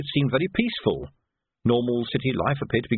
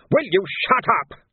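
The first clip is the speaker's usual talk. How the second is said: louder, sharper, fed up; exasperated order; 9 semitones higher, 4 dB louder than usual. Acted. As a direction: snap at the chattering boy. Major cuts Nobby off again.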